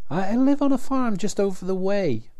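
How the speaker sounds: high, stammering plea; a scared young girl pleading with gunmen; frightened girl. A narrator's reading. Frightened girl answers the armed riders, high and stammering.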